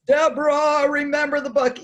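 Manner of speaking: booming voice of God; loud mock divine voice; Charlton Heston voice. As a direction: proclaim it like a movie God. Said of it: "like Charlton Heston".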